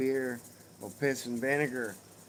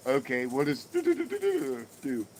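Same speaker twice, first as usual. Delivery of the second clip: higher, louder echo of the babbled riddle; playing along straight-faced; 4 semitones higher, 5 dB louder than usual. Dad humors his daughter's joke, mock straight-faced babble. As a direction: repeat the nonsense riddle as a dutiful dad. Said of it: "with a straight face and go".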